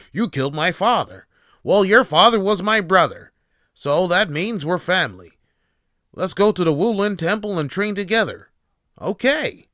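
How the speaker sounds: imitating a cheesy eighties kung fu movie dub; bad kung fu dub. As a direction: ham it up like a badly dubbed kung fu film. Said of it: "terrible dubbed".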